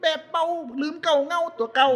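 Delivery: high-pitched acted scolding; hammed-up high protest; mock scolding critic. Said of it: "แล้วเถียงกันคนหนึ่งมาเขียนหักษา คนก็จะว่าแล้ว".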